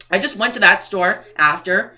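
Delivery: ordinary delivery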